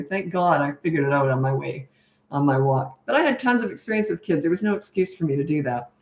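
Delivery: normal talking voice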